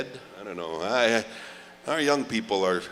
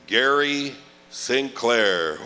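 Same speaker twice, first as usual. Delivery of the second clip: slow presentation call; 3 dB louder, much slower than usual. Acted. Ladies and gentlemen presentation.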